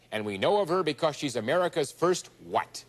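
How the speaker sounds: ordinary talk, nothing marked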